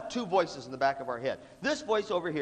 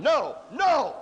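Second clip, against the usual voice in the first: high, drawn-out mock outrage; raised, slow hammed-up reproach; protesting conscience; 11 semitones higher, 3 dB louder, much slower than usual. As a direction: scold like a horrified conscience. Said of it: "And this voice going".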